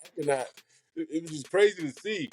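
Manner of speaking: normal talking voice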